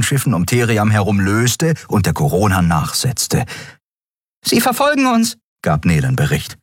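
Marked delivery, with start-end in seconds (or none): 4.4-5.4 very high little voice; small winged crewmate. Acted. Crewmate reporting pursuit to the pilot, high-voiced.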